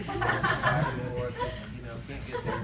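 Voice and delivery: mocking the waiting-room fish, slow and laughing; laughing mock drawl. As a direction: stretch it out through a laugh. Mimicking the fish.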